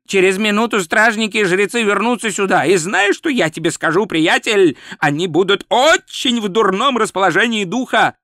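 high, exasperated scolding; growling. Acted. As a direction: scold a clueless companion urgently. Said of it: "зарычал Ринсвинд".

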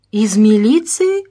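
disbelieving. A narrator's reading. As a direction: draw the question out in disbelief. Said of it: "не поверил мужчина". (недоверчиво, с удивлением)

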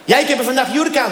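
coy foreign cleaner in broken Dutch; Brazilian accent. Retold or imitated.

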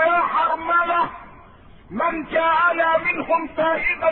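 preacher voicing the Prophet's stern, dignified answer; solemn proclamation. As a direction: declaim each phrase slowly like a decree. Preacher voices the Prophet's reply.

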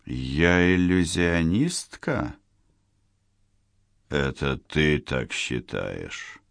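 slow, soft sarcastic inner voice; mock her quietly, unimpressed. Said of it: "саркастически подумал Майрик".

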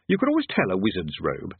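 ordinary delivery

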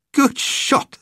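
high, airy mock of a stiff Englishman; mocking the English narrator's praise; mocking English echo. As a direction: parrot it back in posh parody. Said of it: "God, how English".

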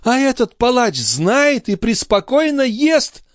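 high, slow, outraged lament; countess wailing indignantly. A narrator's reading. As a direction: howl in theatrical exasperation. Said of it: "взвыла графиня".

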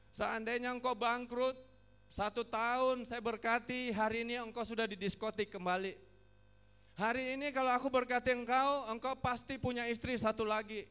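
loud, high-pitched retold reproof; forceful divine warning. God answers the bankrupt preacher, forceful warning. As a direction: declare it loudly as God's stern answer. Voices God's answer in a sermon.

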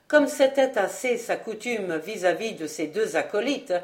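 ordinary delivery, nothing marked

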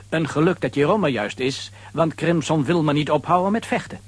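normal talking voice

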